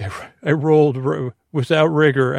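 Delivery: laughing at his own joke mid-word; a quick amused laugh breaking in. Real, not acted.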